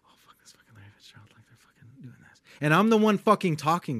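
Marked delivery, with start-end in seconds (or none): 0.1-2.4 fast, hushed whisper; imitating frantic whispering; whispered freak-out. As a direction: whisper rapidly like starstruck coworkers behind someone's back. Coworkers secretly freaking out behind him.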